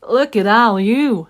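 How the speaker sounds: beaming over her new babies; joyful. Acted. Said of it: "said Mama Duck with joy".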